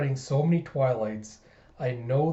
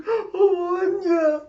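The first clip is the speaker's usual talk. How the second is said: hammed-up tearful gush, very high and loud; high-pitched overcome cooing; deeply touched mother; 6 dB louder, much slower than usual. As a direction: melt with mock emotion. Mother moved by child's praise.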